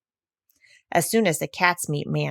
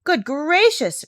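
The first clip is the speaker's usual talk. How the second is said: slow, higher astonished exclaim; surprised cry; 9 semitones higher, 4 dB louder, slower than usual. Acted. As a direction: gasp it out in wonder. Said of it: "cried the doctor".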